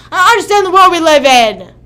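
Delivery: yelled out loud and high, in frustration; sudden shout. Real, not acted. Streamer yelling her frustration at chat. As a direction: blurt it out as a loud outburst. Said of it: "Sorry, that was totally not uncalled for".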